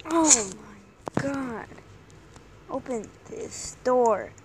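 ordinary delivery

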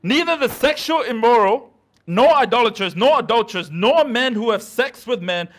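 loud, forceful preaching from scripture; emphatic scripture reading. Real, not acted. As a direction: declaim each item of the list loudly. Declaims a scripture list to church.

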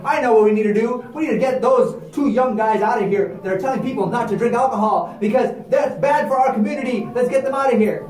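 loud, angry mob shouting. Acted. Angry mob men shouting to run the preachers out. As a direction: rile up the crowd, shouting.

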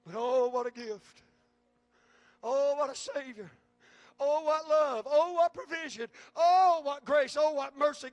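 building, loud awe-filled praise; rising praise. Acted. Preacher exulting in praise.

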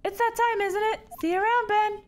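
high cheery small-talk voice; chipper character voice. Acted. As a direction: chirp a cheerful greeting. Same character voice.

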